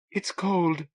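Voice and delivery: a tiny, frightened little voice. A narrator's reading. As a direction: barely push the words out, shivering. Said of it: "she said in a tiny voice".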